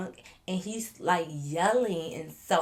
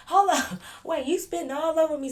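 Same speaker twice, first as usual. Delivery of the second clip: laughing disbelief; 8 semitones higher, 4 dB louder than usual. Retold or imitated. Laughing while being spat on.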